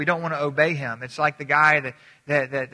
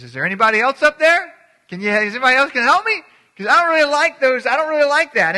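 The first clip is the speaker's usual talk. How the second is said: high, loud mock plea; comic loud complaint, pitched high; calling out for help; 10 semitones higher, 10 dB louder than usual. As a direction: holler up a cliff for someone else. Cliff hanger calls up for someone.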